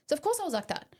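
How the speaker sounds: usual speaking voice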